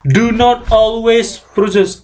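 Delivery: ordinary talk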